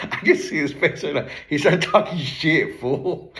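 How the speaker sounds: real amused laughter in the voice; talking through laughter. Real, not acted. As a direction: tell it while cracking up at the memory. Cracking up at friend's teasing.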